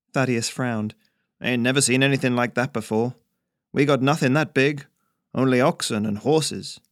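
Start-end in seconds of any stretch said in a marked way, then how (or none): 1.4-6.8 louder character voice for a folksy stranger; gruff-minded out-of-town trader. Acted. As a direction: play the rustic out-of-towner, sizing things up.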